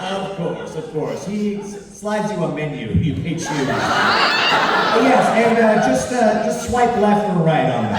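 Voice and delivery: loud, eager servile waiter; obsequious host voice. Acted. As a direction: fawn over the guests. Baloth serves the players.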